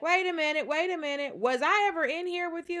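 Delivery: high-pitched, urgent questioning; retold disbelief, high voice; mock startled realization. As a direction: act out a sudden puzzled realization, pitched well up. Acted startled question to genie.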